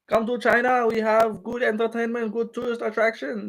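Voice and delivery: high-pitched mock Chinese accent; Zhang Weili. Streamer imitating a fighter after her win, high accented voice. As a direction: pitch it up like a scripted tourism pitch. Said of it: "Do a Zhang Weili impression".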